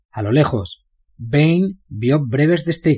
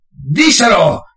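repeated order; 7 semitones higher, 7 dB louder, much slower than usual. Acted. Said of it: "repitió Bane".

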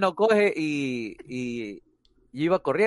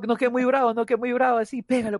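higher, eager urging to hit; egging him on; 8 semitones higher than usual. Retold or imitated. Friend at the schoolyard fight urging Steven on, hyped higher voice. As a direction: push him, hype him up to strike. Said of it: "me dice".

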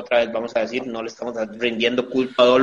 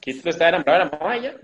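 very fast, higher-pitched summary; rattled off impatiently; rushed spoiler; 4 semitones higher, much faster than usual. Real, not acted. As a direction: blurt it out in a hurry to be done.

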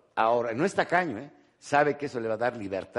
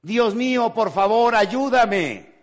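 loud, high cry for help; man hanging from a cliff shouting to God for help; desperate shout; 8 semitones higher, 7 dB louder, slower than usual. Acted. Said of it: "Entonces gritó".

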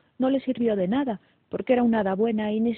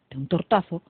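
usual speaking voice

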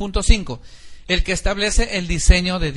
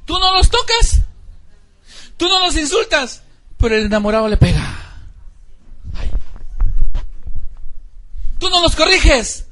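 higher, louder indignant challenge; 8 semitones higher, 3 dB louder than usual. Acted. Pastor confronting parents over abusive boyfriends, indignant.

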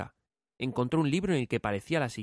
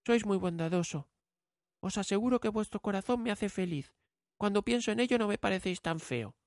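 put-on young girl voice; 9 semitones higher than usual. A narrator's reading. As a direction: speak kindly as the girl. Bella answers.

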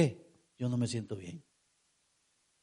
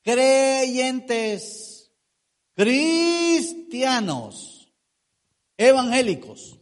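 emphatic list; 5 semitones higher, 4 dB louder, much slower than usual. Acted. Preacher calling out titles.

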